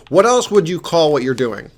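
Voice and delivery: loud, incredulous challenge; exasperated. Retold or imitated. Mentor retelling a challenge to an argumentative student, exasperated.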